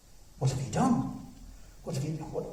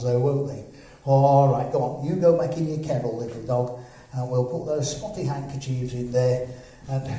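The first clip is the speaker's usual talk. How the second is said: usual speaking voice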